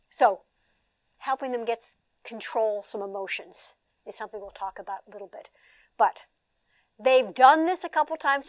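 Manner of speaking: ordinary talk, nothing marked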